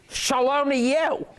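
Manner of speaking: "Shalom to you" with a rising, questioning lilt on "you"; slow, sing-song "Shalom to you" ending high; demonstrating the wrong way to say "Shalom to you"; wrong intonation. Shown as a demonstration. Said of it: "you wouldn't say it with that intonation in your voice".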